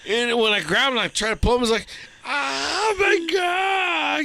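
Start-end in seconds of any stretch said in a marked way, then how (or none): 2.2-4.2 high, drawn-out mock scream of pain. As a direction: imitate him howling as he's grabbed. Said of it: "He screams 'cause she's holding on".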